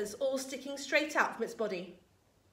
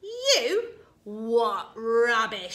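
scornful yell; 8 dB louder, slower than usual. A narrator's reading. Grandma disbelieving George's new medicine, scornful yell. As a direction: scoff loudly, dragging out the last words. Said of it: "she yelled".

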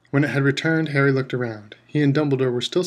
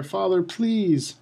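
desperate plea; 6 semitones higher, much slower than usual. A narrator's reading. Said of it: "said the boy".

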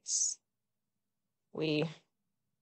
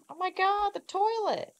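high squeal of shock, much higher. Real, not acted. Gamer spots the toilet, high shocked squeal. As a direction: shriek at the mess.

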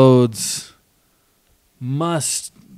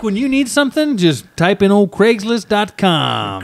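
mock wise advice; 8 semitones higher than usual. Voicing himself recommending Craigslist.

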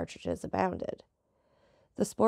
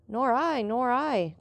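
lightly voiced sailors, higher pitched; chorusing sailors; 6 semitones higher, 4 dB louder, slower than usual. A narrator's reading. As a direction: chime in one after another, a hint of a crew. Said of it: "chorused the sailors".

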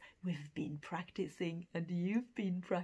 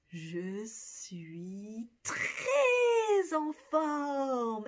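très drawn out very slowly; slow model; 3 semitones higher, 4 dB louder, much slower than usual. Shown as a demonstration. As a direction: je suis TRÈS en forme, each word slow. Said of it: "What do you think we would say".

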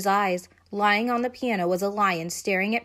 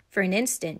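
ordinary delivery

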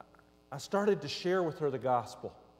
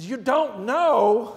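loud, high, drawn-out disbelieving question; retold incredulity; 7 semitones higher, 9 dB louder, much slower than usual. Driver to the woman he rescued, loudly incredulous. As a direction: stretch it out in astonished disbelief.

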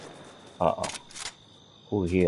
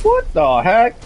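high, loud disbelief; outraged surprise; 13 semitones higher, 7 dB louder than usual. Real, not acted. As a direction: yell in disbelief. Enemy survives.

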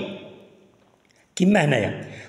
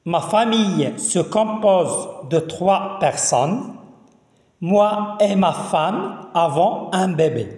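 slow, carefully articulated French for learners; slow teacher's reading with drawn-out words; read-along model reading; slower than usual. Shown as a demonstration. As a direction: read it out phrase by phrase, leaving gaps to repeat. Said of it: "je relis encore une autre fois".

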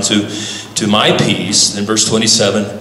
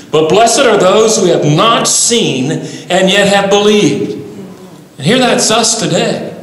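full-force preaching; 7 semitones higher than usual. Acted. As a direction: preach it out with swelling fervour. Preacher voices Jesus then exhorts congregation.

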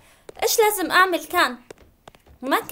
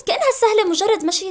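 usual speaking voice

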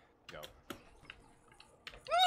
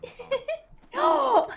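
high, loud and slow, laughing; hammed-up proud yell; 16 dB louder than usual. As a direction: belt it out like a silly joke. Joking about a game character.

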